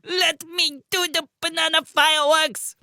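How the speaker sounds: squeaky cartoon protest; high whiny voice. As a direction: whine it high and insistent. Pim Pim struggling.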